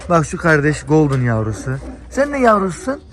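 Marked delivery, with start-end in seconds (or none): 2.1-3.0 playful pet talk. Real, not acted. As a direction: coo at the puppy playfully. Talking to a golden puppy.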